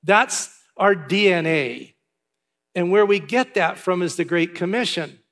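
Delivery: usual speaking voice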